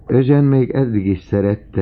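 high, loud burst of delight; loud and elated; excited exclamation. A narrator's reading. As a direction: exclaim it with rising enthusiasm. Excited exclamation about the beloved.

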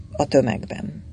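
ordinary delivery

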